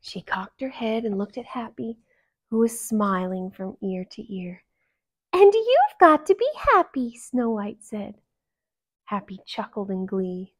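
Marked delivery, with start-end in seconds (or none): 5.3-7.1 sweet princess voice. Acted.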